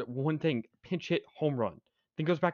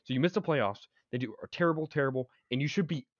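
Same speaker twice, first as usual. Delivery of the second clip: usual speaking voice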